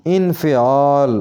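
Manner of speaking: इन्फ़ियाल with 'fi' before the long 'aal'; correct pronunciation. Shown as a demonstration. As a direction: say इन्फ़ियाल as 'in-fi-aal'. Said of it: "इसका सही तलफ़ुज़ होगा". (इन्फ़ियाल में 'फ़ि' अलग से बोलें)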